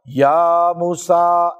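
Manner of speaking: very slow, stretched call of یا موسیٰ; drawn-out divine call. Acted. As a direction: draw out یا موسیٰ like a recitation. Divine call to Musa voiced. (یا موسیٰ کو بہت آہستہ کھینچ کر پکارنا)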